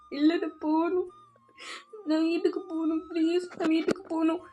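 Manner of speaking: soft, acted crying plea; tearful pleading. Girl begging to be let home after a sudden hug, tearful. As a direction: beg quietly through mock tears. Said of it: "Sri ரொம்ப அழுக ஆரம்பிச்சிருப்பா".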